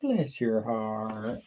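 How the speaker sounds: drawn-out soft bless your heart; soft slow echo. Shown as a demonstration. Husband echoing his wife's phrase, soft and slow. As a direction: echo it sweetly and slowly. Echoes the phrase slowly, softly.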